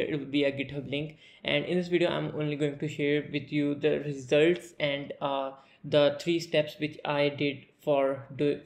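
ordinary talk

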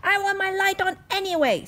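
princess insisting. Acted. Princess insists to the grown-ups, high and loud. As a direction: dig in like a stubborn child.

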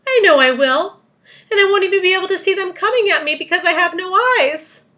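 high, loud, sustained lament; wailing. A narrator's reading. Eyeless earthworm awaiting the seagulls, wailing. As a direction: wail on in self-pity. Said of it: "wailed the poor earthworm".

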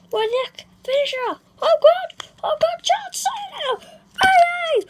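very high, loud screaming announcer; excited commentary. Pretend. Boy calling the toy match finish in wild excitement.

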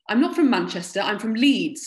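heated narrated comeback from a soldier; irritated retort. A narrator's reading. Soldier retorts in acted quarrel.

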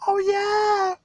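high squealed oh yeah; giddy squeal. Retold or imitated. Collector reliving the box opening, high squeal. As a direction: squeal with delight at the find.

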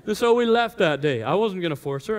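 normal talking voice

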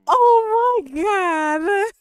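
real high, loud, stretched squeal; overcome, near tears. Real, not acted. He just told her I love you, tearful squealing. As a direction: cry it out, melting with joy. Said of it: "I'm about to cry".

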